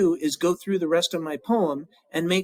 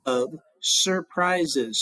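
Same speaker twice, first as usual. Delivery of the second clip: counting syllables; much slower than usual. Shown as a demonstration. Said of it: "So surprises has more".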